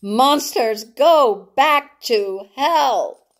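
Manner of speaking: slow, drawn-out high scream. Acted. Rena screaming up at dive bombers in rage. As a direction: hurl it up at the planes. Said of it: "she screamed at them".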